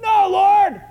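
high, loud outburst of refusal; mock defiant shout. Peter standing up before expectant angels, defiant shout.

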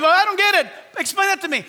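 high, loud, exaggerated bafflement; interrupting congregant. Women halt the service, shouting in confusion. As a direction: holler for an explanation. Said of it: "if these women started".

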